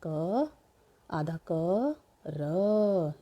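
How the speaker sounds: क, आधा क, र held out with pauses between; sound by sound. Shown as a demonstration. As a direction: stretch क and र as separate sounds to be joined. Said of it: "ये क्या बन गया हमारा". (क, आधा क, र अलग-अलग खींचकर)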